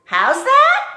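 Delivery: very high, loud yelling; shouted question. Acted. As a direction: holler it back. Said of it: "shouted Mig".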